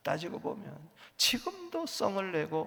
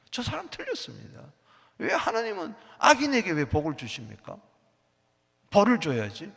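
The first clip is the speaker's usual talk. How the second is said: self-righteous outcry; 4 semitones higher, 6 dB louder than usual. Acted. Self-righteous believer demands punishment.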